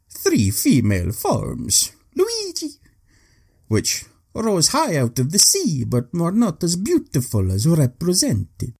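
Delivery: hammed-up bad Italian accent; a mock Italian explorer. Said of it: "This is my ridiculously bad attempt at an Italian accent".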